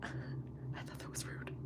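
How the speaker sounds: breathy secret whisper; hushed aside. Real, not acted. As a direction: lean in and whisper it. Whispered aside about rude comment.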